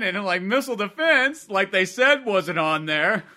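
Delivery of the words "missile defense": "missile defense" slow and raised in pitch, as if quoted; in air quotes. Retold or imitated. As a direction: stretch "missile defense" to set it in quote marks. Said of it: "it's, like, in quotes".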